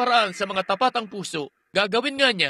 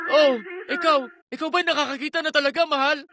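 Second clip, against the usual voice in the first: a wife marvelling that her husband sees; amazed joy; 5 semitones higher than usual. Retold or imitated. Wife asking her healed husband, high and amazed. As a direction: voice lifted high in a rush of wonder.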